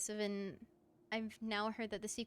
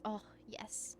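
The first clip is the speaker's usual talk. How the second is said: whisper; 4 semitones lower, 8 dB quieter than usual. Real, not acted. Aside while playing.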